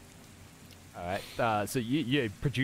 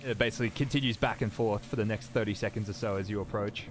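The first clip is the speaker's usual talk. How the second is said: ordinary delivery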